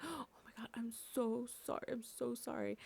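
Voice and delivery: gasping, whispered mock panic; breathy frightened sorry, half whispered; imitating scared apology. Bumped partygoers apologizing in fear, whispered and gasping.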